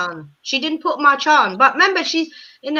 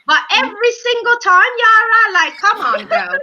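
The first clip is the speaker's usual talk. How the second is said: exasperated call-out; 6 semitones higher, 3 dB louder than usual. Real, not acted.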